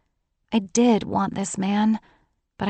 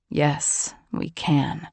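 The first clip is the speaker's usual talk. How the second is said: a hint of a chuckle in a deeper voice; amused John; 4 semitones lower, much slower than usual. A narrator's reading. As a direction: lower man's voice with a light amused edge. John's gentle insistence.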